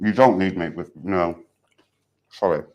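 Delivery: talking with a mouthful; words round a bite of food; mouth full. Real, not acted. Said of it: "Talking with my mouth".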